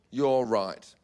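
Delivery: low, measured, deliberate agreement; mock-solemn concession. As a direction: concede gravely with long pauses. Mock concession to flight attendant.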